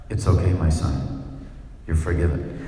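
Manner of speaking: deep hammed-up priestly absolution; solemn priestly voice. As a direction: bless them like a pompous priest. Pastor mock-forgiving a stranger.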